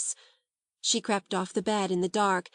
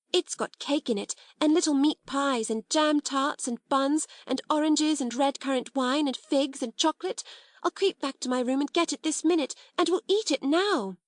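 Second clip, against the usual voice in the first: tumbling words; 6 semitones higher than usual. A narrator's reading. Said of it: "Her words began to tumble over each other".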